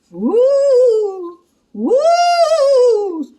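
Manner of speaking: high, loud spooky woo; ghost wail. Acted. Wind sounds like ghosts.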